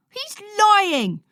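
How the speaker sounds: shout. A narrator's reading. Boy shouting that his brother lies, furious. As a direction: yell the denial. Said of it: "Lloyd shouts".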